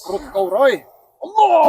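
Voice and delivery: loud spell-casting cry; shouted incantation. Acted. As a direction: bellow the curse at the enemy. Spell attack in a mock duel.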